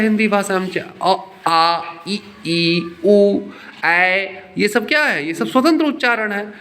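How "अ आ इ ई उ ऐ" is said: अ, आ, इ, ई, उ, ऐ as separate pure vowels; independent vowel sounds. Shown as a demonstration. Teacher showing the class that vowels stand alone, each sounded separately. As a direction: sound out अ, आ, इ, ई, उ, ऐ one by one. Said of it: "उसका उच्चारण स्वतंत्र होता है".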